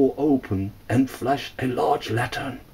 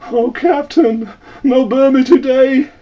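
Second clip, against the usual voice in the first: high, loud, desperate begging; crying out for mercy in pidgin; terrified plea; 12 semitones higher, 6 dB louder than usual. A narrator's reading. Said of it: "he cried to the captain in his pidgin for mercy".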